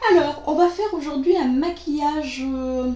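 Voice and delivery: parler encore à travers le rire, plus fort; en riant. Real, not acted. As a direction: garder le rire dans la voix. (phrase dite en riant, forte)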